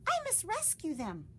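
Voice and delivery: a lightly voiced determined bat. Stellaluna resolves.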